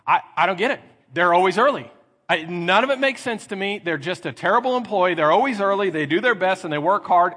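mildly hammed-up mock grievance; exasperated boss. Boss complaining about an always-early employee, mock exasperated.

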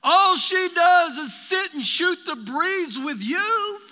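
loud, high, demanding complaint; a fed-up sister scolding; intense. Acted. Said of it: "I sense an intensity within her voice".

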